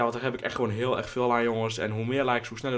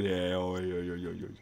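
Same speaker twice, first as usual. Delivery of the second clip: usual speaking voice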